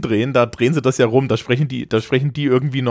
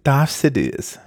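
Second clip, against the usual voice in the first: "Darth Sidious" in a posh British accent; slower than usual. Shown as a demonstration. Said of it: "war das mit britischem Akzent?".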